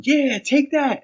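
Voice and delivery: high, excited cheer; cheering yell. Retold or imitated. Fan cheering the winning fighter, high excited yell. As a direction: cheer the winner on like a rowdy fan. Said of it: "we're yelling".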